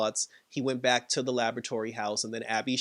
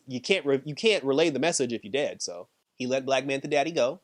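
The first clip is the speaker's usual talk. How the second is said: ordinary talk, nothing marked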